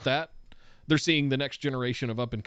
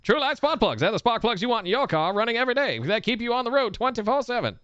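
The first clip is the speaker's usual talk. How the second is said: put-on old-time radio ad announcer; 1950s narrator; 7 semitones higher than usual. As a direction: sell it like a fifties radio spot. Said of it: "nineteen fifties announcer voices".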